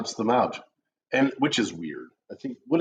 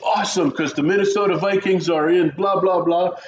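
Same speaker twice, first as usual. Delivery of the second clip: imitating an excited sports fan; higher, loud rush of fan enthusiasm; 6 semitones higher, 4 dB louder than usual. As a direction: gush like a thrilled football fan. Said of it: "very excited".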